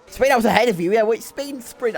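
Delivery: loud, high-pitched objection; indignant protest. Real, not acted. Indignant repeat arguing with chat.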